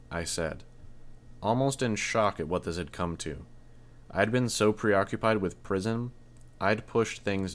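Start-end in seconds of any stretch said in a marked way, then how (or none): none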